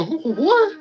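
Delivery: high startled double take; sudden high confusion; surprised. Pretend. Viewers react in surprise.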